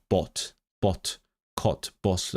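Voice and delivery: "bot, cut, bus" said as a light Yorkshire accent; a hint of Yorkshire in "bot, cut, bus". Shown as a demonstration. Showing Yorkshire vowels, lightly voiced. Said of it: "Yorkshire would be".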